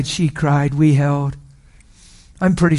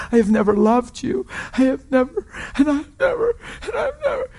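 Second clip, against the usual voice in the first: building from raised pitch to a high, crying squeak; tearful confession; 10 semitones higher than usual. Retold or imitated. Husband confessing he never loved his wife, tearful high voice. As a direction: break down as you confess, voice climbing.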